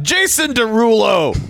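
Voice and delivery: high, stretched mock game-call holler. Shown as a demonstration. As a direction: holler the name high and long. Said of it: "also hollering out".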